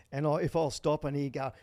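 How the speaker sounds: ordinary talk, nothing marked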